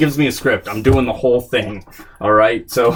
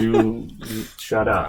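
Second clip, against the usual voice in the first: mock snippy retort; 6 dB quieter, slower than usual. Retorting to the manager.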